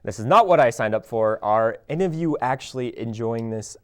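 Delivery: ordinary delivery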